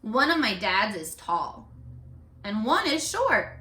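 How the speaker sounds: expressive picture-book reading; read-aloud voice for young kids; reading to children. A narrator's reading. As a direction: carry the class to the story's ending. Teacher reads picture book aloud.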